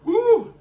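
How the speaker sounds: loud high-pitched woo; high woo from the heat. Real, not acted. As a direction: shout it out as the spice hits. Reacting to spicy heat.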